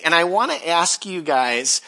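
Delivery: ordinary talk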